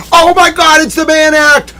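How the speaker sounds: very high, loud squealing cry; ecstatic shriek. Real, not acted. Streamer to chat, squealing with triumph.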